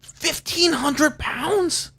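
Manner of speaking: high, slow, incredulous question; a real jaw-drop at the weight; stunned disbelief. Real, not acted. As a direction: gasp it out like you can't believe the number. Shocked at moose weight.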